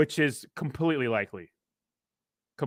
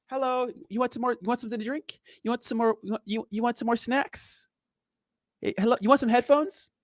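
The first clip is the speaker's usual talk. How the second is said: breezy flight attendant; 7 semitones higher than usual. Retold or imitated. Imitates calm flight attendant.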